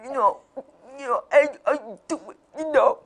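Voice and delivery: imitating a toothless guy's come-on; toothless man hitting on her. Man at gas station making a pass, mock come-on. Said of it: "he's like".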